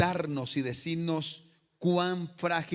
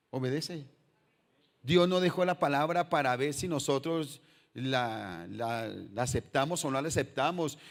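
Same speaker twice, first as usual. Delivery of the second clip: usual speaking voice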